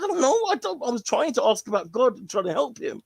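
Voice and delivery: very high, flustered protest; mock panicked defence. Boy defending himself to classmates, high and flustered. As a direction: plead that you meant well.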